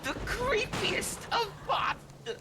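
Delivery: slow, drawn-out villain whisper climbing high; creepy wizard, whispered and stretched out; evil wizard giving a slow, eerie command. Acted. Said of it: "someone's R P with an evil wizard".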